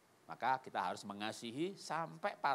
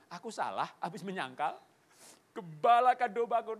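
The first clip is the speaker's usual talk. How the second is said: acting out tearful, happy emotion; rising higher and louder, as if overcome; moved to tears; 8 semitones higher, 8 dB louder than usual. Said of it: "dia nangisnya terharu, bahagia". (pura-pura menangis terharu)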